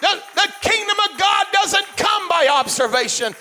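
loud, high, shouting preacher; fiery preaching. Acted. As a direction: preach it full force, building. Preaching at full force.

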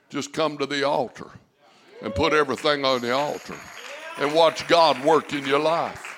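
rising preacher's call; preaching. Acted. Pastor urging the church toward the altar, preaching. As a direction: preach it to the congregation.

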